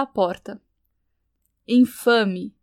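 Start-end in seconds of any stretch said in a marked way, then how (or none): 1.7-2.5 angry roar. A narrator's reading. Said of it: "rugiu Álvaro".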